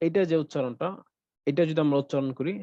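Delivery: usual speaking voice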